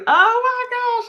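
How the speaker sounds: high, loud, drawn-out exclamation; delighted outburst. Real, not acted. Host reacts to J Dub's accent comment, delighted outburst. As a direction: squeal it out slowly in disbelief.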